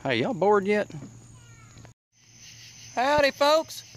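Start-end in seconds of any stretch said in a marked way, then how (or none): 2.9-3.8 high, twangy "Howdy, folks" greeting; Catfish Cooley voice. Shown as a demonstration. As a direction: greet the folks like a country showman. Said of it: "I sound like Catfish Cooley when I did that".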